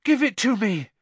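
desperate demand. A narrator's reading. Harry struggles for his broom.

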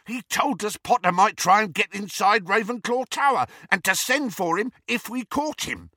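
high, frantic explanation; worked-up, rough villain; agitated henchman. A narrator's reading. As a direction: blurt it out defensively. Amycus blurts out his orders.

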